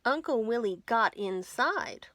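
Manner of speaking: ordinary delivery, nothing marked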